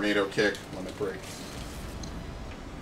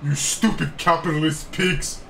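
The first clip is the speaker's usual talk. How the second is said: Bond villain voice; 5 semitones higher, 3 dB louder than usual. Retold or imitated. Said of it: "everyone speaks like a Bond villain".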